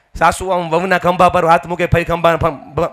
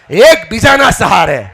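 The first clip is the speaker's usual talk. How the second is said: એકબીજાના સહારે shouted slowly, higher; shouted repeat; 6 semitones higher, 5 dB louder, slower than usual. Real, not acted. Speaker repeats the chant line to the crowd, shouting. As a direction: call એકબીજાના સહારે out hard for the echo. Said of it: "બોલો મારી હારે".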